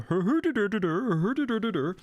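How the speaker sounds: high-pitched mock-Swedish gibberish; cartoonish singsong Scandinavian babble; Swedish Chef. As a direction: burble nonsense syllables way up high. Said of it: "the Swedish chef and the Muppets going".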